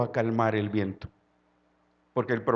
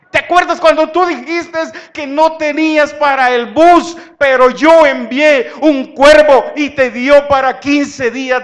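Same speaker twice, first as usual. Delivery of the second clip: loud, high voice of God recalling miracles; God pressing the listener to remember; God reminding, preached; 9 semitones higher, 15 dB louder than usual. Acted. God speaking to the believer.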